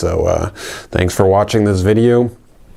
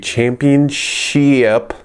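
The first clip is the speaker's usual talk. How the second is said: announcer drawl; 4 semitones higher, much slower than usual. Pretend. Drawn-out announcer word.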